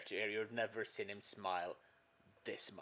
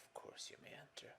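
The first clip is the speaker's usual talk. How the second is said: whispering; 13 dB quieter than usual. Acted. Answers wife's whisper.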